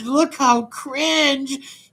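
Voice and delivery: loud, high-pitched, drawn-out jeer; jeering outburst. Real, not acted. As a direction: crow it slowly in scornful disbelief. Jeering at the played story.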